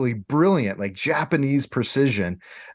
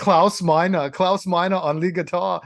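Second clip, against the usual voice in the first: a hint of a German rocker introducing the band; German accent; 5 semitones higher than usual. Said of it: "Is that my German accent?".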